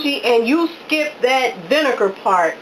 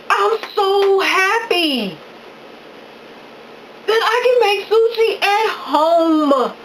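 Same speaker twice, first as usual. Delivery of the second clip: higher and slower, savoring each word; overjoyed; 7 semitones higher, slower than usual. Real, not acted. Gushing to viewers about making sushi at home, overjoyed. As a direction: gush with happiness, slowly. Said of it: "I'm so happy I could cry".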